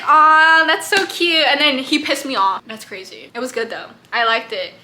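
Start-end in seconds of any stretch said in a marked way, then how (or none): 0.0-1.5 louder, higher fond cooing; playfully swooning reaction; gushing aww. Retold or imitated. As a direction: gush over a sweet moment. Acts out her fond reaction to Jack.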